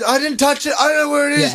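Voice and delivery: imitating a panicky kid, pitched high; high-pitched, put-on denial; defensive child protest. Dad imitates son who thinks he's in trouble, defensive.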